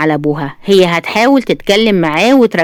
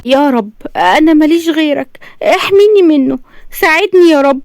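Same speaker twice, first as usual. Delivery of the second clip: high-pitched, desperate plea to God; tearful prayer; 7 semitones higher than usual. A narrator's reading. Suffering captive woman praying to God, tearful plea. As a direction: plead inwardly in a high voice. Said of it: "نزلت دموعها وقالت في نفسها".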